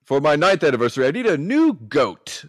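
lightly voiced old craftsman; hint of a silly craftsman. Voicing clocksmith again.

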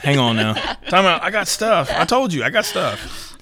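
laughing protest. Retold or imitated. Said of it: "Wait a minute".

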